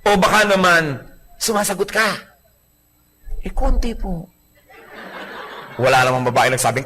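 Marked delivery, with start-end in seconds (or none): 3.4-4.2 sheepish admission. Retold or imitated. Wife admits answering back.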